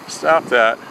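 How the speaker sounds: ordinary delivery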